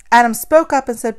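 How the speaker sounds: ordinary delivery, nothing marked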